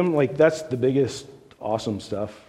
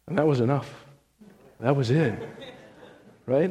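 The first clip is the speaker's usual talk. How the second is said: usual speaking voice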